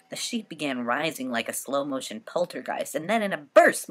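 ordinary delivery, nothing marked

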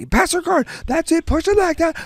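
high, strained imitation of matside yelling; mock screaming dad. Imitating the dad beside the mat, high-pitched screaming. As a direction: holler instructions like an overexcited dad. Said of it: "And he's screaming".

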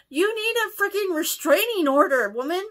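high, loud outburst at the friend; outraged exclamation. Real, not acted. Reader reacting to the creepy friend, outraged shout.

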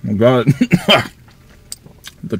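chewing while talking; talking around food; mouth full. Real, not acted. Seen 0: Bites burger at -4, cheeks full and chewing through 2.4s.

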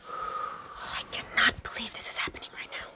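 disbelieving whisper; hushed whisper. Acted. Hiding, voices lowered.

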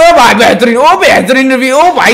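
high, loud hype; excited shout. Real, not acted. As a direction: yell it at the screen. Hyped at big moment. (जोश में ऊँची चीख)

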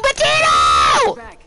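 long low drawn-out shout of a name. Real, not acted. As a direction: drag the name out in a yell. Calling teammate after going down.

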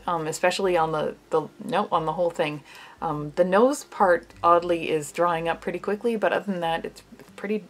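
wet mask over lips; mask-covered mouth; through a sheet mask. Real, not acted.

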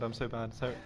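normal talking voice

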